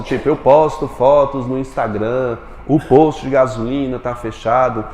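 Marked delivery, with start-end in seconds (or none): none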